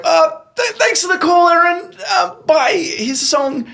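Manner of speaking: very high, loud panicked sign-off; squeaky awkward sign-off. Retold or imitated. Host hurrying the caller off air, squeaking high and loud. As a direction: squeak out a rushed goodbye to the caller. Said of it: "And I'm like".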